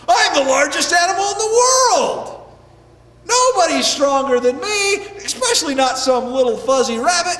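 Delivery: loud, puffed-up whale voice; big animal's indignant boast; boastful whale. Acted. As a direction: boom it out with pride.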